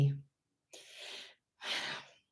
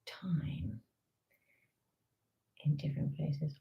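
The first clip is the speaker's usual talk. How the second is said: whisper; 3 semitones lower, 12 dB quieter than usual. Real, not acted.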